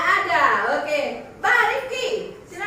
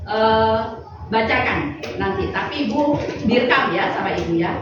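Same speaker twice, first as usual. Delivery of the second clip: formal announcement; 4 semitones lower, 6 dB louder than usual. Real, not acted. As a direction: announce the lesson goals to the class. Teacher states lesson goals. (pengumuman resmi guru, lebih keras dan pelan)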